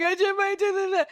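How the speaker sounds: high-pitched mock wail; hammed-up tearful panic. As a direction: blurt it out in an exaggerated crying squeal. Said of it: "my tears are blocking it".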